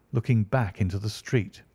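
plain reading voice

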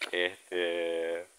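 ordinary talk, nothing marked

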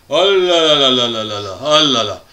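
dismayed oh là là. Acted. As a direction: lament it in a rolling chain, sliding down. Rueful after a mishap.

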